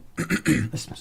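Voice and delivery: a quick rough throat clear. Real, not acted. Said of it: "Sorry, excuse me".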